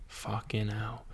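an awed whispered curse, barely voiced. Real, not acted. Thinking of the first birth, whispering in awe. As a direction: breathe it out in disbelief. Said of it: "awed at the thought".

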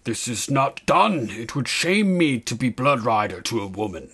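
put-on hesitant young warrior's character voice. A narrator's reading. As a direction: object uncertainly, drawing the words out. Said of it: "he said hesitantly".

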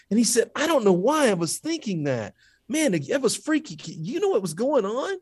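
high, excited retelling of a freaked-out man; spooked stranger. Retold or imitated. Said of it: "this man turns and looks at me and says".

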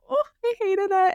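high, strained groan of disgust; exasperated high wail. Real, not acted. Shopping trip, hating every outfit, high exasperated wail. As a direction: squeal out how much you hated it.

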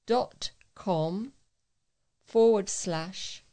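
slow and deliberate, so listeners can write it down; slow dictation. Shown as a demonstration. Reader spelling out a web address for listeners, slow and deliberate. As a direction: spell it out slowly, letter by letter. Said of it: "so if you need to find a pen and pencil".

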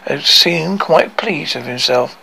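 usual speaking voice

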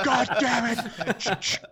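high, drawn-out laughing cry; laughing outburst. Real, not acted. Reacting to the gorilla twist with a long laughing cry. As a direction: crack up and shout it to the sky.

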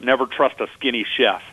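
normal talking voice

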